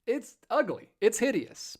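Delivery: ordinary delivery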